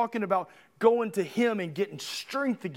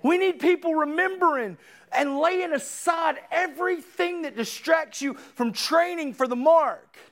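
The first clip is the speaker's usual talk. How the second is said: higher, driving sermon delivery; forceful preaching; 6 semitones higher than usual. Real, not acted. Preacher urging church people off the bench, forceful preaching. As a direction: preach it hard, line after line.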